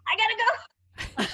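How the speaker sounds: high, slow laughing gotta go; mock panicked exit. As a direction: squeal it while laughing. Panicked exit while leaking.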